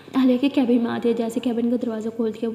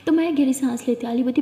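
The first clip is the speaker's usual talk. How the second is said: ordinary delivery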